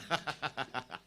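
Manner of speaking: low, soft sinister chuckle; frightening laugh. Real, not acted. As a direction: let out a creepy villain laugh. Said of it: "that was a quite frightening laugh".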